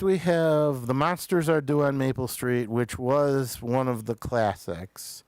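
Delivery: ordinary talk, nothing marked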